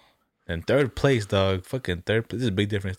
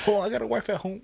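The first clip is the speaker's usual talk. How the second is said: very high-pitched comic pleading; mock protest; 13 semitones higher than usual. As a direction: play a squeaky, hammed-up excuse. Joking as panicked sailor in story.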